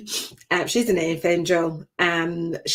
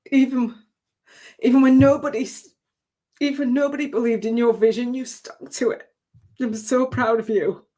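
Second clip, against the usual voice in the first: tearful, raised pitch, quoting her granddaughter through tears; voice breaking; 5 semitones higher than usual. Real, not acted. Grandmother retelling her granddaughter's praise, voice breaking. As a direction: fight back tears on each word. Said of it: "I'm gonna try not to cry".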